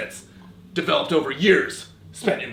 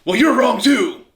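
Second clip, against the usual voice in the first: higher, louder yelled correction; loud retort; 8 semitones higher, 8 dB louder than usual. Acted. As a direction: yell it at the student. Sensei yells at student.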